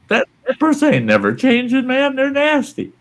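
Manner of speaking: high, insistent voice; mock warning. Voicing someone telling her.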